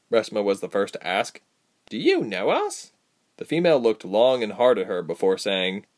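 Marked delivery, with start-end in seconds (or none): 1.9-2.9 high-pitched query; higher voice, probing; questioning heroine. A narrator's reading. Captive asking the female creature, high question.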